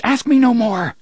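high, loud desperate cry; cried out. A narrator's reading. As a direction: cry out to stop the questions.

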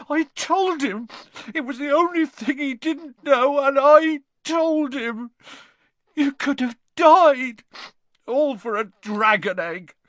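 high, loud tearful wailing; sobbing. A narrator's reading. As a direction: confess wretchedly through acted sobs. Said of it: "he sobbed, his face in his hands".

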